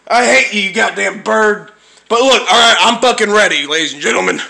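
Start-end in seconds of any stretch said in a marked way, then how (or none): none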